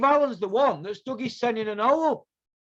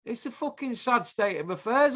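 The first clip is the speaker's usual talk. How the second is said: ordinary talk, nothing marked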